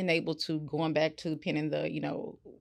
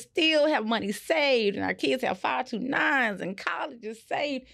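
posh lady of leisure; 8 semitones higher, 5 dB louder than usual. Pretend. Dreaming of a life of leisure.

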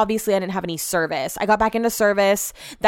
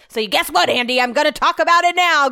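higher and louder, calling Andy out; defiant outburst; 5 semitones higher, 3 dB louder than usual. Real, not acted. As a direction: throw it at Andy like a challenge. Venting at Andy.

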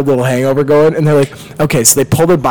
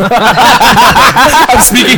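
mock possessed voice; 7 semitones higher, 4 dB louder, much slower than usual.

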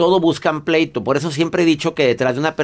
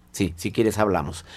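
low, soft concession; calmed down; 6 semitones lower, 7 dB quieter than usual. Acted. Doctor voicing the other person after cooling off, calmed and quiet. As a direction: answer quietly, much lower, the fight gone out of it. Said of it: "la gente se tranquiliza".

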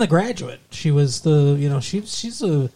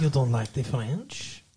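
softer mock-French accent; 6 dB quieter than usual. Boyfriend needling fiancée in a mock French accent. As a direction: keep up the silly French accent. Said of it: "my French accent".

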